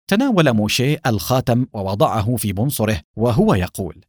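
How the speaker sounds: usual speaking voice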